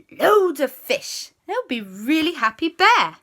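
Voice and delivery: high, loud, stretched enthusiasm; excited suggestion. A narrator's reading. Planning a treat for the bear, excited. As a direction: pitch the plan with glee. J B has a fish idea.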